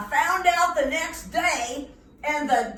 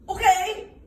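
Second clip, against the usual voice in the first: eager okay; 8 semitones higher, 7 dB louder than usual. Acted. Boy agrees to cowboy.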